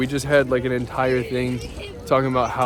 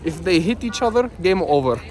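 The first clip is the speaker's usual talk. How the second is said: mock Russian accent; 7 semitones higher, 5 dB louder than usual. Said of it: "We were like".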